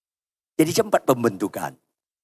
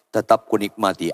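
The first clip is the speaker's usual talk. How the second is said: normal talking voice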